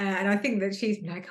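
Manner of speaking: usual speaking voice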